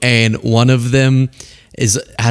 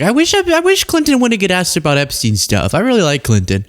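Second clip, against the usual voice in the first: wistful impersonation, pitched up then settling; Trump; 7 semitones higher than usual. Retold or imitated. Commentator imitating the president regretting questions about Clinton, wistful impersonation. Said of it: "and Trump's like".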